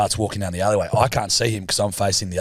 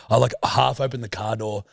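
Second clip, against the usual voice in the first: usual speaking voice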